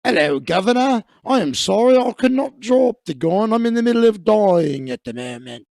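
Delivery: put-on loud, hammy Cockney accent. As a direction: play the dying man as a loud mock Cockney. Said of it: "everybody's got the same accent".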